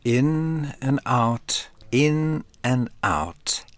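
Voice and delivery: very slow, drawn-out in and out; slow breathing cue. Shown as a demonstration. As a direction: pace the breaths slowly. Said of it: "pacing tidal breaths".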